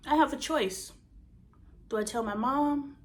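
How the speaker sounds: normal talking voice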